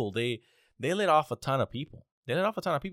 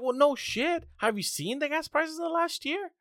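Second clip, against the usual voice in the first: exasperated retort. Retold or imitated. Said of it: "And I'm like".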